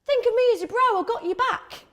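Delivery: high-pitched, hearty bro voice; everyone's brother. Acted. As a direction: play the chummy bro offering support. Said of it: "the guy who's like everyone's brother".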